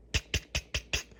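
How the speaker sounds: soft tongue clicks for knocks; little tick-tick knocks; imitated baby knocking. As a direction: click out small quick taps.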